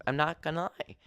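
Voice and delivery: usual speaking voice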